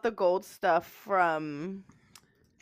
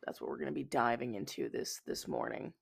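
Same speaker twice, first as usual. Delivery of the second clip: trailing off; 6 semitones lower, 10 dB quieter than usual. Real, not acted. Voice fades at sentence end.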